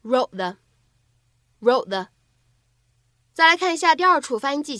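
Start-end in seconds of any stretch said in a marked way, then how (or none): 0.0-2.1 "Wrote the" said slowly, the t held and not released into "the"; incomplete plosion demo. Shown as a demonstration. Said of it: "我们可以读成rode the". (慢速示范"Wrote the"的不完全爆破)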